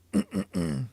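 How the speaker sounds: a quick rasping throat clear. Real, not acted. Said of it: "sorry, I'm losing my voice".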